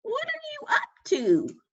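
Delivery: a grumpy little critter woken up; grumpy Hedgehog. A narrator's reading. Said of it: "grunted Hedgehog".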